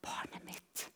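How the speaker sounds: barely audible tender whisper; very soft, breath-only voicing of God; God's whispered answer. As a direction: whisper it as God's patient reply. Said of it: "så sier han".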